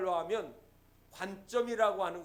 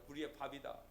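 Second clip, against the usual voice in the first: 우리의 밥이다 repeated much softer and lower; quiet echo; 9 semitones lower, 13 dB quieter than usual. Shown as a demonstration. Preacher softly echoing Caleb's words to the congregation. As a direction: murmur 우리의 밥이다 back, savoring it. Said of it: "그랬습니다".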